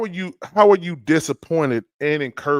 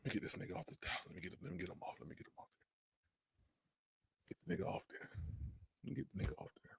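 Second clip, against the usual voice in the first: muttered under breath; 7 semitones lower, 22 dB quieter, much faster than usual. Real, not acted. Muttering to self off mic.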